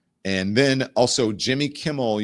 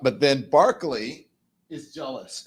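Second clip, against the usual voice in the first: ordinary delivery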